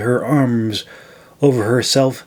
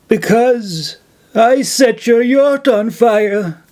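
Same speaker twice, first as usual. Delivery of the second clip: higher, slow sheepish crone's confession; confessing old woman; 8 semitones higher than usual. A narrator's reading. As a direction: own up slowly in an old voice. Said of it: "Nadette confessed".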